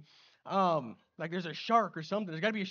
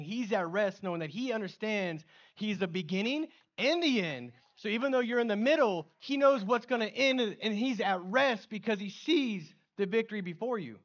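preaching at full force; 5 semitones higher, 7 dB louder than usual. Acted. Preaching climax about Jesus at rest.

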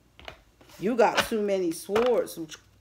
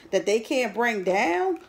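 normal talking voice

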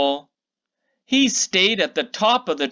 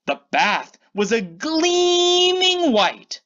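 wonder-filled reveal; 9 semitones higher than usual. A narrator's reading. Grand final reveal.

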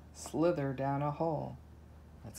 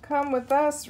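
much higher, cheerful cubs' call; roaring together; 9 semitones higher, 4 dB louder than usual. Acted. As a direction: invite them warmly like happy cubs. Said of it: "roar the lion cubs".

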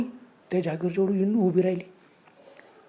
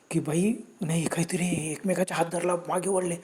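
usual speaking voice

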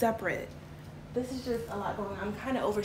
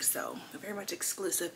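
whisper; 3 semitones lower, 7 dB quieter than usual. Real, not acted. Secret exclusive product reveal.

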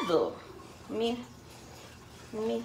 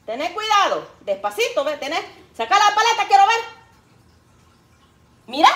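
mother's voice; 7 semitones higher, 6 dB louder than usual. Retold or imitated. Mother voiced instructing daughter.

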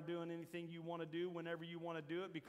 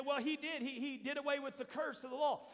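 imitated objection; 6 semitones higher, 3 dB louder than usual. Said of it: "You say".

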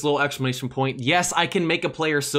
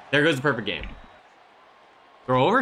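usual speaking voice

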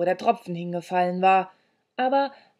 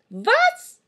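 very high, loud screech; shrieked question; 7 dB louder than usual. A narrator's reading. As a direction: screech it like a deaf old crone. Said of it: "kreischte sie".

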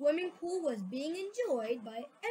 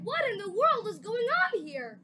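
higher, louder astonished question; shocked demand; 8 semitones higher, 7 dB louder than usual. Acted. The parents demand to know what is happening, shocked. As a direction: demand an explanation. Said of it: "they cried".

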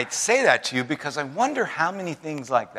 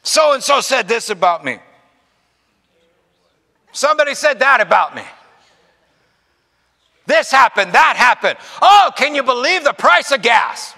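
higher, louder, whining mock grievance; louder, higher mock gripe of a congregant riled over nothing; petty complainer; 10 semitones higher, 8 dB louder than usual. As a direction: ham up the fussing of someone sweating small stuff. Mimics people's petty complaints.

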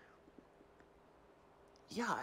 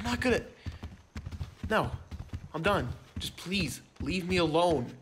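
angry dismissal; 2 semitones higher, 6 dB louder than usual. Acted. Fired employee rejecting boss.

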